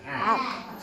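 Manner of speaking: A with the jaw dropped; open a sound. Shown as a demonstration. Teacher modelling the open vowel for the class. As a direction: open the mouth on A. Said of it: "con la boca hacia abierta".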